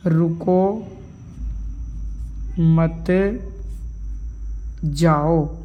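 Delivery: very long pauses between रुको, मत and जाओ; slow word by word. Shown as a demonstration. Teacher rewriting the sentence for students, slow word-by-word dictation. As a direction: stretch each word out while writing. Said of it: "देखो मैं दोबारा लिख देता हूँ".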